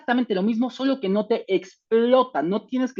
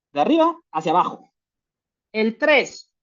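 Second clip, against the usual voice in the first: ordinary talk, nothing marked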